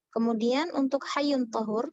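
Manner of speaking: normal talking voice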